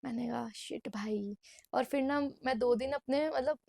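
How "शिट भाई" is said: slow, hushed whispered curse. Retold or imitated. Vlogger retells realizing her family is coming, whispering in dismay.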